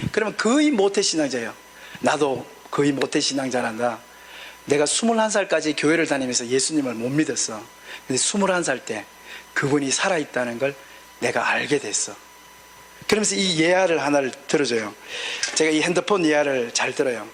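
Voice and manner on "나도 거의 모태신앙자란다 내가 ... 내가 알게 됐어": gentle confession. Retold or imitated. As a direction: speak low and gently, as to a young believer. Pastor gently answers a youth.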